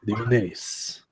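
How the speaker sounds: Unis said softly in a sultry Latin accent. Shown as a demonstration. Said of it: "like, in a Latin accent".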